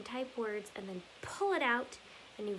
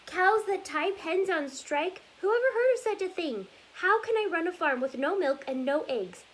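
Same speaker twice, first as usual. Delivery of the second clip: high, loud exasperated rant; furious; 6 semitones higher, 10 dB louder than usual. Acted. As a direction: rant like a fed-up farmer at his wits' end. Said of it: "Farmer Brown was furious".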